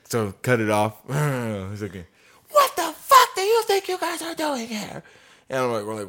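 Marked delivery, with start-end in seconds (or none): 2.5-5.0 very high-pitched outraged screech; mock crazy screaming. Resident confronting two pressure washers, high mock shriek. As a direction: imitate a woman shrieking at workers. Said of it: "What are you going crazy for".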